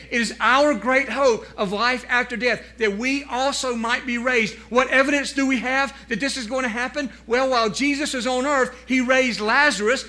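loud, rising sermon delivery; preaching. Acted. Preacher driving home the resurrection to the congregation, preaching. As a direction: preach it out with force.

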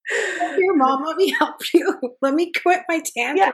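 high, loud pretend kid offering help; mock child voice. Friend joking as the child, high playful mock voice. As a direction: play the suddenly helpful child.

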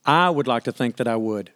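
ordinary talk, nothing marked

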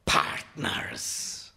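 harsh growl on a single drawn word; strange, growling summons; growled command. A narrator's reading. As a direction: growl it like a bird-masked dance caller. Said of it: "He growls in unknown accents".

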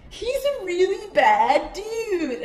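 high, slow, drawn-out mock warning; warning friend. Friends warning her about the man she chases, mock warning. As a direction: imitate a friend, stretching each word high.

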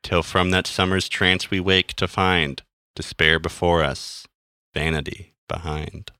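poem reading. Acted. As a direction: read it like a somber poem. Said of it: "I'm going to read this poem now".

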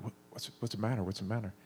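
father checking on his weeping son, softly; soft worried hush. Retold or imitated. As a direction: hush it gently to a crying child. Father soothing crying son at night.

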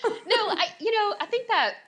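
talking through laughter; laughing. Real, not acted. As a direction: laugh while speaking. Laughing after office remark.

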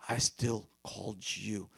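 slow, low and deliberate; slow assurance. Shown as a demonstration. Preacher repeating Jesus' promise slowly to the congregation. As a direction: let each word land softly.

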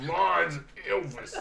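slow, drawn-out silly voice; odd character voice. Acted. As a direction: redo the weird voice, half laughing. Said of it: "What the fuck was that last voice?".

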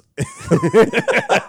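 very high, loud peal of laughter; loud cackling laugh. Real, not acted. Actor cracking up at the pub story's punchline, loud high laugh. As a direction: crack up completely.

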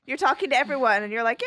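ordinary talk

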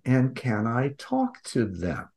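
ordinary delivery

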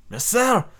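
calm authority dismissing earthly freedom. Acted. Commander voiced in read story.

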